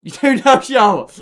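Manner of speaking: surprised shout. Real, not acted. Reacting to big donation.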